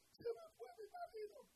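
high whining imitation of a weeping wife; mock crying wife. Preacher mocking a wife who came to cry, high mock wail. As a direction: squeak it like a tearful woman.

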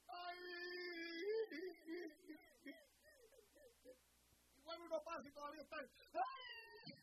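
high mocking ay of someone crying; mock wail. Preacher mocking those who stay crying, high mock wail. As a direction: whine it like a self-pitying crier.

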